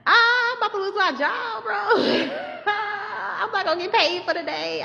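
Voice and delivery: high-pitched hammed-up wail; mock crying. Teacher fearing she'll lose her job, high mock crying. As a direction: whine it through exaggerated fake sobs.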